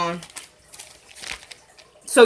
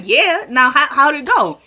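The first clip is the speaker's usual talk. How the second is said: higher, louder, insistent retold question; imitating an unabashed eavesdropper; nosy pressing; 6 semitones higher, 4 dB louder, slower than usual. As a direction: press eagerly for the gossip, playfully acted.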